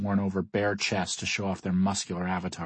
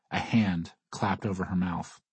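ordinary delivery

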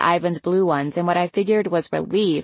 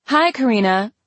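higher-pitched called greeting; mildly raised voice, calling hello; 5 semitones higher, 3 dB louder than usual. A narrator's reading. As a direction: call a hello across the table. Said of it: "each called out".

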